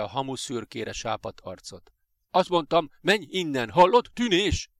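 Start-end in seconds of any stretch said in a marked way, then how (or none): 2.3-4.7 loud, high, insistent yell; furious raised-voice order; angry shout. A narrator's reading. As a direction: bellow at the boy to go. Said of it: "kiáltott rá a férfi".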